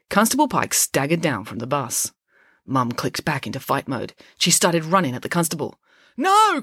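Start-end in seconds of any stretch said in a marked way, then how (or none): 6.2-6.6 high, loud cry of protest; alarmed cry. A narrator's reading. Joe stopping Mum attacking a constable, crying out. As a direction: cry out urgently to stop her. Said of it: "cried Joe".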